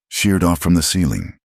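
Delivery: ordinary delivery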